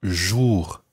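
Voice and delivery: JOUR with a rounded back oo, not fronted; French vowel. Shown as a demonstration. Teacher correcting Anna's vowel sound, rounded vowel. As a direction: round the lips through JOUR. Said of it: "Watch the vowel sound that you make there".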